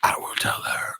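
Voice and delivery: mock-sinister whisper; whispered menace from the boss; ominous whisper. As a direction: breathe it like a quiet threat. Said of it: "they're like".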